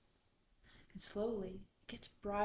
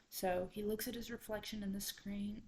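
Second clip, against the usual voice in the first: plain reading voice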